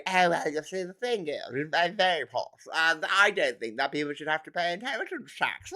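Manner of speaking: lightly voiced posh man; mock the smug posh caller; posh think-tanker. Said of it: "he's going".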